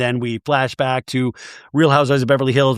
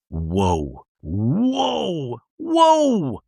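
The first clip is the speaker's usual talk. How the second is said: each whoa more amazed than the last; stunned whoa; much slower than usual. Real, not acted. Reacting to sixty-one-year-old giving birth.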